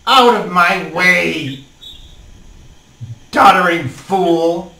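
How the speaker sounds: slow, loud gravelly ogress. Acted. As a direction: growl it out like an old hag. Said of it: "She says in a gravelly voice".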